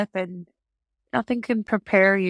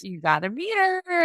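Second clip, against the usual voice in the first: higher-pitched mock encouragement; imitated coaxing; 9 semitones higher than usual. Retelling staff urging her to push, mock coaxing. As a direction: lightly voice a cheerleading helper.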